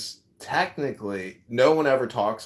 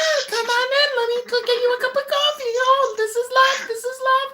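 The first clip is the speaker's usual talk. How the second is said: much higher squeaky female voice; squeaky woman's voice; 5 dB louder than usual. Retold or imitated. Friend imitating the comic's woman character, squeaky and high. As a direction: squeak like a fussing hostess. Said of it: "really squeaky women's voice".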